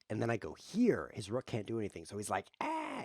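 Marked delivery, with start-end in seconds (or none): 2.6-3.0 much higher mock "Ah!" squeal; mock alarmed shriek. Opponent's rook trapped, a high mock shriek. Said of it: "in another color".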